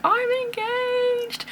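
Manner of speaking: high and slow announcement; excited shout. Retold or imitated. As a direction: sing it out with joy to the house. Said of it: "I just shouted".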